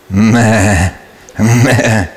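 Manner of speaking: low bleating meh; sheep bleat. Retold or imitated. Sheep bleating at the new king.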